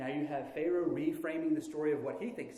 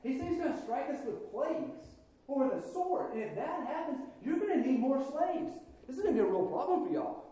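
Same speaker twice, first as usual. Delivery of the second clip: higher, pushy sales pitch; persuasive Israelites; 8 semitones higher than usual. Pretend. Israelites pressing Pharaoh about lost workforce, higher and pushy. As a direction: talk the king round with a warning.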